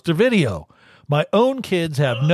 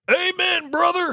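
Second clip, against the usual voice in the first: higher, bigger wrestler shout; wrestler catchphrase. Retold or imitated. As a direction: pro wrestler promo yell. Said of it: "What wrestler says that?".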